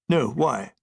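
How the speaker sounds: stuffy British officer; posh general voice. Acted.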